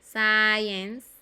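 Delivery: "science" loud, with a long "eye" vowel; pronunciation demo. Shown as a demonstration. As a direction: model "science" loudly, stretching the I as "ai". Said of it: "la I es I". ("science" fuerte, alargando el sonido "ai")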